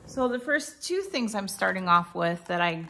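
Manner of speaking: ordinary delivery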